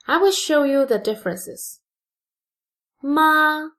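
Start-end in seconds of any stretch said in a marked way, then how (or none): 3.0-3.7 MA held high and level; "Ma" in a steady high tone; first tone. Shown as a demonstration. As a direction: sustain "Ma" on one high, flat pitch. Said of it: "is the first tone".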